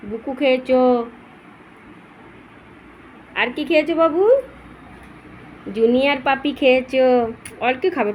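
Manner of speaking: fond scolding of a naughty puppy; talking to the dog. Real, not acted.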